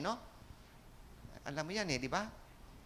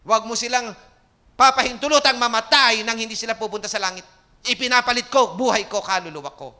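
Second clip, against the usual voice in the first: loud, higher, desperate plea to God; pleading prayer; 5 semitones higher, 10 dB louder than usual. Retold or imitated. Preacher reliving his prayer for his family, loud and pleading. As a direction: cry out the prayer like bargaining your life. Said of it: "sabi ko".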